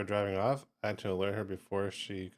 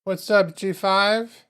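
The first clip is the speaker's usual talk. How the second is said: loud excited greeting; 10 semitones higher, 10 dB louder, much slower than usual. Real, not acted. Greeting a viewer by name.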